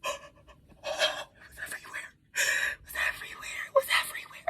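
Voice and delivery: voiceless, stunned whisper; horrified whisper. Retold or imitated. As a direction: whisper it in disbelief, over and over. Reliving horror, choked whisper.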